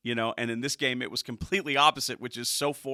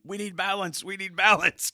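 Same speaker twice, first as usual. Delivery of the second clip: high mock yell, louder on the repeat; imitated fan yelling; 7 semitones higher, 5 dB louder than usual. Co-host mimics fans in the stands, mock yelling. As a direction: mimic a complaining heckler, pitched up. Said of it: "things being yelled".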